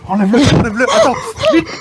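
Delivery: high, urgent panicked voice. Acted. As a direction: rush him in alarm. Panicked rush to remove mask. (panique aiguë)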